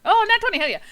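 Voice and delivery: high thrilled cry over a great roll; excited. Real, not acted. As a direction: cheer it out, loud and high. Natural twenty rolled at table.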